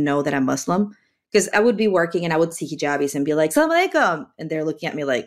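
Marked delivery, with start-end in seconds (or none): 3.5-4.3 pitched-up cheery salam; eager, higher hello; cheery greeting. Retold or imitated. Greeting hijabis at work.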